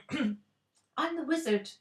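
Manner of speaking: high, slow croak; little old man croaking his name. A narrator's reading. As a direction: croak it out timidly. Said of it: "croaked the man".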